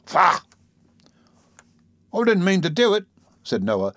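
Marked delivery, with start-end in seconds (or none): 0.1-0.4 short, higher exclamation of disgust; indignant. A narrator's reading. Beadle disgusted at the kissing couple, indignant. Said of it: "in strong indignation". 2.1-3.1 high-pitched, lightly whining excuse; blubbering. A narrator's reading. Noah excusing himself to the beadle, mild blubbering.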